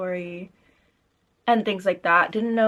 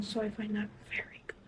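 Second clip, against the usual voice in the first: whispering; 9 dB quieter than usual. Real, not acted. Whispered aside while filming.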